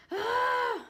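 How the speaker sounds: sudden high loud yelp of heat; a high loud mock wail of heat; overheated cry. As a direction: cry out like the room is an oven. Boyfriend cranks up the heat.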